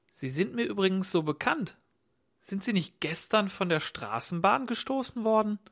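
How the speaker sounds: probing call. A narrator's reading. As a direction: call out, pressing for recognition. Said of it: "blickte seinen Gast durchdringend an und rief". (durchdringend fragend, höhere Stimme)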